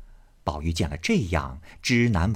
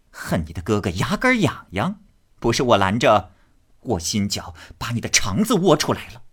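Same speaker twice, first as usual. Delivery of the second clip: threatening scold; 2 semitones higher than usual. Acted. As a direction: threaten him with a beating. Xifeng threatens Jia Huan.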